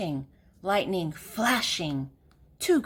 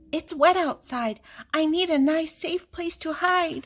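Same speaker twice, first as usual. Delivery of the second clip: higher, fretful hen voice; a small hen begging for shelter; higher pleading hen; hen's voice; 9 semitones higher than usual. A narrator's reading. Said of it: "said Hen".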